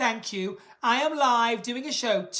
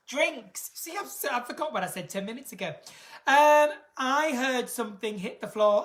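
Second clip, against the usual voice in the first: ordinary talk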